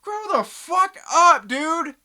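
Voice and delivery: high, loud angry yell; angry shout. Real, not acted. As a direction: yell it at him, fed up. Ranting at critics.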